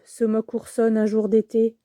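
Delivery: usual speaking voice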